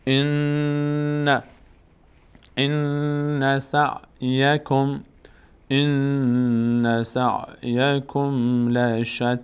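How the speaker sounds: very slow chant, holding the ن of ان in the nose; nasal hold on ان. Shown as a demonstration. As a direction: let the doubled ن in ان hum before moving on.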